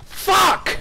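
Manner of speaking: high-pitched angry expletive; sharp cry of exasperation. Real, not acted. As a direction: burst out in sudden real frustration. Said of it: "how angry I got for the very last match".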